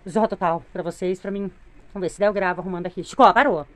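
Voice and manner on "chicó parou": sharp call. Real, not acted. Calling off the dog.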